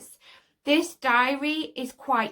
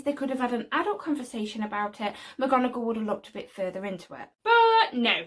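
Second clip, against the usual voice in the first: normal talking voice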